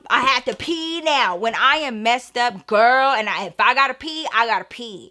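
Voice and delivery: ordinary talk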